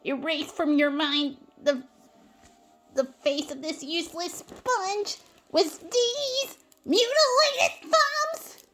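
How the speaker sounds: rising to a high, slow, loud wail; anguished outburst. Acted. SpongeBob shows his ruined thumbs, high anguished wail.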